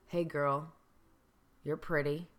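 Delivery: sleazy come-on; creepy catcall. Shown as a demonstration. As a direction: catcaller in a dark alley. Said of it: "I'm gonna say it a few times".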